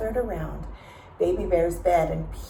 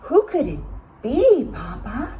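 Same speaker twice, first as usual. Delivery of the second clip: a small bear asking his dad, unsure; wondering Baby Bear; 6 semitones higher, 4 dB louder than usual. A narrator's reading. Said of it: "wondered Baby Bear".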